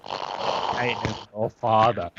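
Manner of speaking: a hint of Darth Vader. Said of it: "Darth Vader having a cold".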